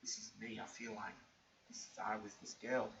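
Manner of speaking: breathy near-whisper to yourself; hushed inner thought. Retold or imitated. Hearing the girl's story, thinking to himself, hushed whisper. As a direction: whisper the realisation inward. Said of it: "I'm thinking".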